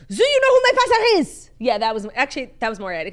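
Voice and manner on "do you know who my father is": very high, loud put-on French accent; a hammed-up French heir pleading at the door. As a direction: redo the heir in French, less aggressive. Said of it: "I was doing the wrong accent".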